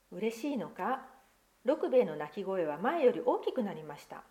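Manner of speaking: put-on lively picture-book reading to children; story narration. Pastor reading a picture book to children, lively storytelling. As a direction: read the tale aloud to the congregation, expressive.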